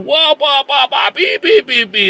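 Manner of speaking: high, loud nonsense preaching babble; mock preacher babble. Mocking how churches preach at the congregation, high babble. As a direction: parody a preacher rambling on. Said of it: "Gereja tiap hari ngotbahin jemaat".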